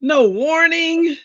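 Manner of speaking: high, slow, drawn-out mock disappointment; mock dismay. Telling viewers the medicine knocked her out, mock dismay. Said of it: "I was like".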